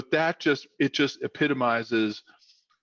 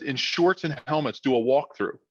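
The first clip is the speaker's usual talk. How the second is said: usual speaking voice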